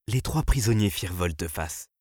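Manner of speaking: usual speaking voice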